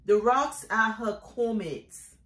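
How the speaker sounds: declaimed poetry line, full voice; firm poem refrain. Acted. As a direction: state the refrain firmly, falling at the end. Poem refrain, first firm take.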